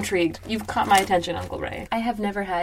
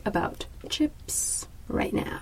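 whispering; 6 dB quieter, slower than usual. Real, not acted. Whispering during a call.

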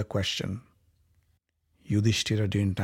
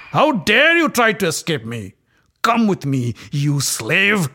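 higher, louder vicious snarl; enraged; 5 semitones higher, 6 dB louder than usual. Acted. Dussasana snarls at the fleeing queen, enraged. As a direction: seize her and spit the words. Dussasana grabs her hair.

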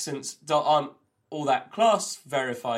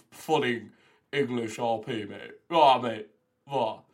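put-on full English RP accent; posh English RP; 3 dB louder than usual. Shown as a demonstration. Showing friend how posh full RP gets, mock English RP accent. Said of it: "less posh than just".

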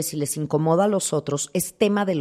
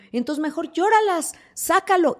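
emphatic urging; 10 semitones higher than usual. Acted. Urging listeners to cry.

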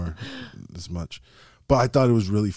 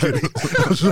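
ordinary talk, nothing marked

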